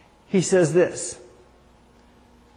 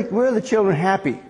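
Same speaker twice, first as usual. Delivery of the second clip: usual speaking voice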